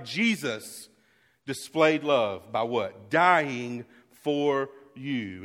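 loud preaching, drawing the key words out very slowly; full-force preaching. Real, not acted. Pastor proclaiming First John to his church, loud and slow. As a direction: lean into the verse and stretch it for weight.

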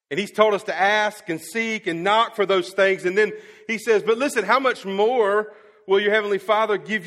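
loud, high, driving sermon voice; full-force preaching. Acted. Pastor confessing his confusion to the church, preaching loud. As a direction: preach it hard to the back row.

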